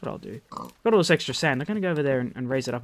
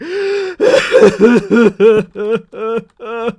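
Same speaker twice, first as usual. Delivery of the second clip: high, loud mock sobs in a stuttering burst; hammed-up blubbering wail, gasping between sobs; imitated sobbing; 7 semitones higher, 10 dB louder than usual. Imitating the man on the train bursting into tears, sobbing. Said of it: "really sobbing, like".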